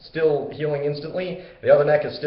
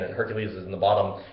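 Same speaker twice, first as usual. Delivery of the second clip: ordinary delivery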